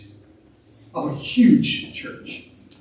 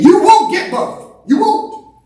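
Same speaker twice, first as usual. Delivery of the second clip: much higher and louder, slow and insistent; forceful preaching; 12 semitones higher, 9 dB louder, slower than usual. Real, not acted. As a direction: hammer the warning home to the congregation. Preacher driving home a warning.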